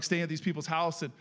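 ordinary talk, nothing marked

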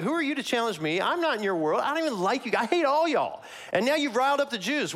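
high-pitched, scornful challenge; attitude. Acted. Pilate challenging the prisoner Jesus, full of attitude. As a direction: put the upstart in his place. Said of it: "Pilate's gonna have some attitude".

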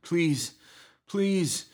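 high, desperate pleas; frightened high begging, a pause between; pleading repeatedly. A narrator's reading. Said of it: "repeated himself between choking coughs".

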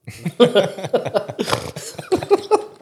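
high snorting laugh. Real, not acted. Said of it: "Did you snort?".

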